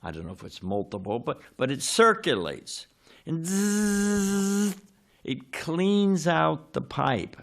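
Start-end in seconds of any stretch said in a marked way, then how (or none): 3.3-4.8 a high buzzing zzz like a spinning drain snake; buzzing tool noise. Retold or imitated. Explaining the Roto-Rooter snake, imitating its high buzz. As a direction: mimic the whirring blade in the pipe.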